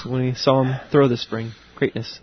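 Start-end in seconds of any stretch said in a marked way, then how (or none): 1.8-2.2 soft whispered echo; hushed reverence. Acted. Co-host echoing the line used in spring, hushed. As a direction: whisper it like a slogan.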